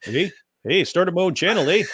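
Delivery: mock a Canadian accent; hammed-up Canadian lilt. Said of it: "this is from Canada".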